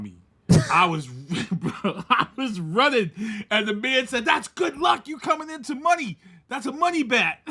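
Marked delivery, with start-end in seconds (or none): 4.2-7.4 high, excited reenacted shout; excited calling out. Local man calling to a fleeing tourist, excited. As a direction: call it out like thrilling news.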